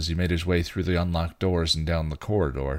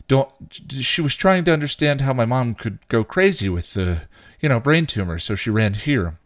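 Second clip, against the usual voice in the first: stumbling, higher-pitched excuse; hasty explaining; 6 semitones higher, 4 dB louder than usual. A narrator's reading. Buffy explains to the intern, hastily. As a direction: scramble for an explanation. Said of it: "explain hastily".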